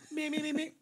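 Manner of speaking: soft, high repeated warm-up notes; little high actor's warm-up; mock vocal warm-up. Performer warming up before an accent demo, mock serious.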